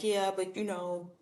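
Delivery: imitating a defeatist friend as Eeyore; mopey Eeyore. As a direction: mope like a gloomy donkey. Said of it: "You just got an Eeyore syndrome".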